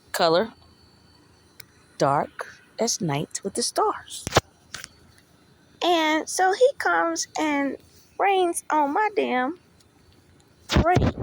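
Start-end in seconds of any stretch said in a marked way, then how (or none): 5.8-11.2 slow, rising and louder to a loud, high "parade"; indignant emphasis. Real, not acted. Venting to listeners how her soulmate spoiled things, rising indignant build.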